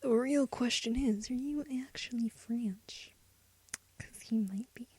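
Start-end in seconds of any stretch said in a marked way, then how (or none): 1.3-4.9 soft unvoiced whisper. Real, not acted. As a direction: whisper the question to yourself. Wondering aloud about name.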